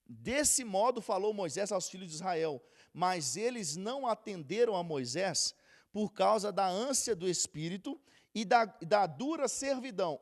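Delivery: loud, slow proclaiming of the verse; scripture reading. Acted. Reads scripture loudly. (leitura bíblica proclamada)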